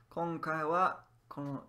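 ordinary talk, nothing marked

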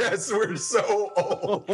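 laughing. Real, not acted. Joking, laughing.

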